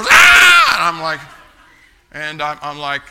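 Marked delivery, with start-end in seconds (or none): 0.0-0.7 very high, loud shriek; shrieking scream. Retold or imitated. Customer reacting to the bloody foot, very high loud shriek. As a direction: hit a piercing high scream of fright. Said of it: "And the customer".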